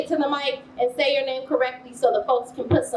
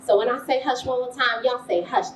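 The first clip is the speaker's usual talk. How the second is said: usual speaking voice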